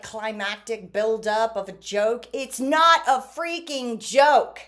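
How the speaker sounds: ordinary talk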